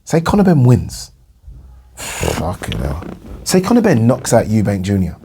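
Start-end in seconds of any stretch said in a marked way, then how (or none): 1.5-3.0 exasperated sigh. Real, not acted. Sighs in disbelief.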